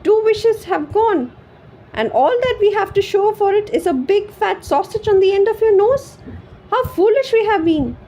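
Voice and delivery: high, loud tearful lament; tearful wife. A narrator's reading. Weeping wife lamenting to her husband, high and tearful. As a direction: lament through tears. Wife crying over the sausage.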